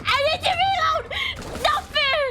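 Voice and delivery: high panicked yelling; yelling while running away. Real, not acted. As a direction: scream while fleeing danger.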